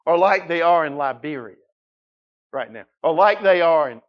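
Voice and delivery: loud, driving preacher delivery; forceful, loud build through a list; preaching. Real, not acted. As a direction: hammer out each scenario to the congregation.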